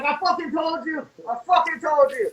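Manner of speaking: high-pitched, re-enacted I-told-you-so; triumphant vindication. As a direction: crow it at the doubters. Retold vindication after finding the scene.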